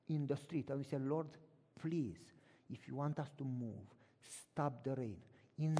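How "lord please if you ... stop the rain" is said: soft, low pleading prayer; hushed plea to God; quiet prayer. Retold or imitated. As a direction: pray humbly on your knees. Kneeling prayer in street.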